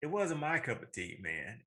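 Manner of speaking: ordinary talk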